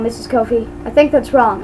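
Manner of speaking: ordinary talk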